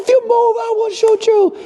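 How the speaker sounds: high, loud mock police threat; cop threat. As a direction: bark the threat like the officer. Imitating police officer threat.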